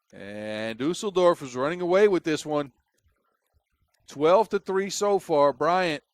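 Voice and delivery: energetic sports commentary; live play-by-play. Real, not acted. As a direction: call the action as it happens.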